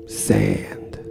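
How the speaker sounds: a whispered single word, breath only; whispered, trailing away. Acted. Coma musing, repeated word.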